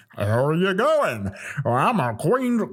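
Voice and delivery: slow, exaggerated Queensland accent; mock Queensland accent. Comedian imagining everyone in Queensland, exaggerated Queensland accent. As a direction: stretch it out like a caricature Queenslander. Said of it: "go to Queensland and everyone's like".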